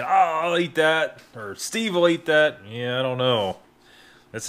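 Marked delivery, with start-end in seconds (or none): 0.0-1.2 high, slow mocking voice; mock a smug viewer, pitched higher. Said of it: "A lot of you guys sit behind the computer saying". 1.5-2.6 mocking viewer voice. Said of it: "A lot of you guys sit behind the computer saying".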